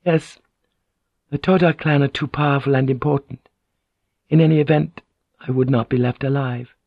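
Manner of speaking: a resigned noblewoman voicing her fate; Mariko voice. A narrator's reading. Noblewoman tells Blackthorn her fate, resigned lady's voice.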